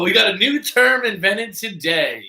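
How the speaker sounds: high, slow, showman's proclamation; excited announcement. Real, not acted. Host on the live stream, excited mock fanfare. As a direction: announce it like big news.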